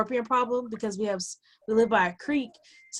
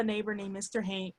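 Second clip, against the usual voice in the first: ordinary talk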